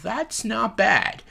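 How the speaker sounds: high, slow, pleasantly surprised; grudging approval. Retold or imitated. Praising Melissa McCarthy's moments, slow grudging approval. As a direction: concede it slowly, a little impressed. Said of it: "there's moments I'm like".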